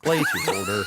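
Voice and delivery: flat robotic order prompt. Pretend. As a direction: speak like a drive-through robot. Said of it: "you're gonna be talking to a robot".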